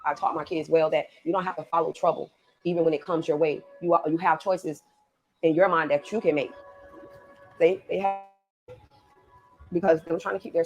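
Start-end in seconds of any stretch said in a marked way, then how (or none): none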